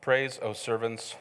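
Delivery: low, droning scripture recital; monotone Bible reading. Shown as a demonstration. Preacher showing church how people read the Bible, monotone. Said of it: "our voices turn monotone".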